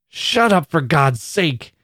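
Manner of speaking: high, tortured outburst slowing at the end; fierce. A narrator's reading. Annie silencing the other girls, fierce cry. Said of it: "cried Annie fiercely, as if in torture".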